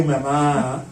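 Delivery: ordinary delivery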